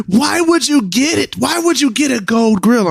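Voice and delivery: high, incredulous mocking voice; whiny disbelieving critic. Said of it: "I was just like".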